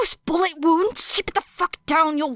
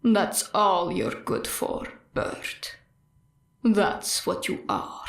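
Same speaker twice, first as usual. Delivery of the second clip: low, slow, sinking to a breath; a quiet cruel verdict, low and slow; cold villain voice; 6 semitones lower, 5 dB quieter, slower than usual. Acted. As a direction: lower and slower, a soft dying sneer. Stain's voice in her head.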